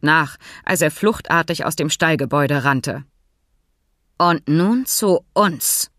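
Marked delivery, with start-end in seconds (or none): 4.2-5.9 langsam knurren; knurrend. Acted. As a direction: drohend anknurren. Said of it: "Finks knurrende Stimme". (Fink wendet sich knurrend den beiden Männern zu)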